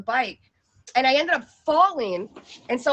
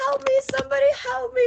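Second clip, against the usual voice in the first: high, fast panicky plea; hammed-up high distress cry; damsel in distress; 11 semitones higher, faster than usual. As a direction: squeal it like a helpless damsel. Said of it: "this damsel in distress".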